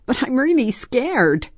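put-on high-pitched frightened child; scared little-girl voice. A narrator's reading. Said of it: "Angela frowned".

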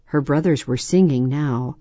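ordinary delivery

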